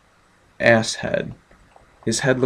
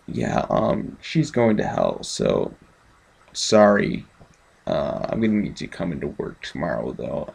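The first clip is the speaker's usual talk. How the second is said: low, soft, deadpan priest brushing it off; a much lower, quieter mock priest, shrugging it off; offhand priest; 7 semitones lower, 4 dB quieter than usual. Reviewer mocks the priest telling a widower, low, soft and deadpan. Mocking the priest's casual reply.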